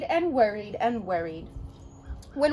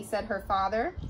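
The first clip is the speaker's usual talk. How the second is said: ordinary delivery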